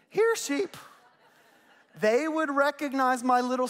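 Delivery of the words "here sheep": high calling shout to the flock; mock shepherd's call; shepherd calling sheep. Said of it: "I don't know what a shepherd says".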